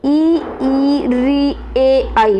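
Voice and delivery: front vowels ई, री, ए, आई voiced one by one; teacher models front vowels ई and ए; vowel demo. Shown as a demonstration. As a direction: say ई, ए, आई separately as examples. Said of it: "जैसे आपने बोला".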